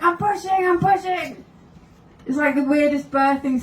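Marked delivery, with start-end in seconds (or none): 0.0-1.4 mock strained protest. Strained reply in mock birthing.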